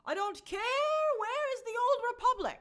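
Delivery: high, loud mock outrage; demanding fans. Host mimics hardcore fans complaining about a video, mock outraged demand. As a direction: imitate indignant fans, stretched and pushed high. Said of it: "hardcore Star Wars fans were like".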